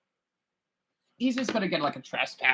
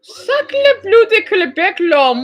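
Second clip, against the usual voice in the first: high, slow hammed-up French accent; french chef; 10 semitones higher, 4 dB louder, slower than usual. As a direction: stretch it like a cartoon French chef. Mock French exclamation at video.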